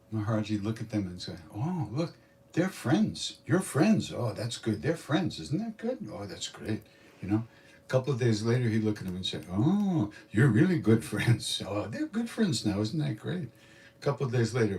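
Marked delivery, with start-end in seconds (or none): 1.5-6.8 high, swooping delight at a new couple; playful mock-amazed approval; delighted guru. Guru cooing over new couple. 9.5-13.5 drawn-out, high swooping "oh" of delight; playful mock-delighted cooing; gushing guru. Guru gushing over the couple.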